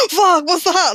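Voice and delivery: sharp gasp then a high, loud alarmed cry; hammed-up fright at a harmless bird; laughing, high panicked yelp; mock startled gasp. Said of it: "Emlyn's like".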